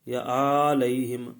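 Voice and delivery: علیہم with its first vowel dragged long and loud; wrongly lengthened vowel. Shown as a demonstration. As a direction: stretch the opening of علیہم into aaa-layhim. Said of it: "اس کو دراز نہ کریں".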